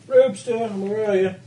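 calling out. Real, not acted. Calling the dog to go out.

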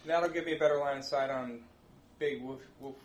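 normal talking voice

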